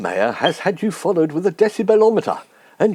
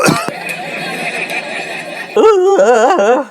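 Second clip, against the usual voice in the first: loud bellow; 8 semitones higher, 3 dB louder than usual. Acted. Crier's bellow after the cry.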